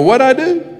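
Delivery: mock bewilderment. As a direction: play baffled patient who slept through it. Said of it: "I go".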